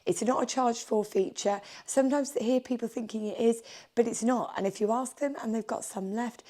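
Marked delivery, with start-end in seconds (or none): none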